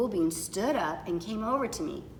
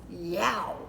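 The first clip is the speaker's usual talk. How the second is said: plain reading voice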